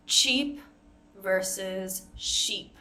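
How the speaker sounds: slow minimal pair; ch versus sh. Shown as a demonstration. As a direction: contrast cheap and sheep. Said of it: "So for example".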